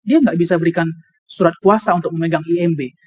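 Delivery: ordinary delivery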